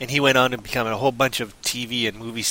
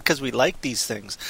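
usual speaking voice

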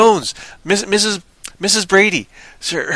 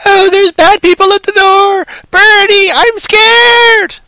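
high, loud panicked wail imitating a film character; top-of-the-voice mock scream; 8 dB louder, slower than usual. Blind character gives away the hiding place, mock scream at top of voice. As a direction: yell it in hammed-up terror. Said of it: "At the top of your voice?".

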